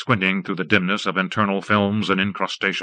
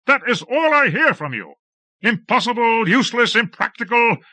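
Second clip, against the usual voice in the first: angry rant; 13 semitones higher than usual. A narrator's reading. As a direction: spit out each word of contempt. Lord ranting at jinxman.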